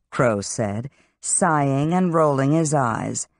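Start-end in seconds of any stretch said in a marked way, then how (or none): none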